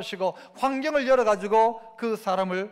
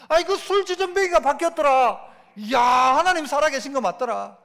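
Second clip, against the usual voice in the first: amazed gossip. Acted. Villagers marveling that the drunkard changed, amazed. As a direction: gasp it like astonished villagers.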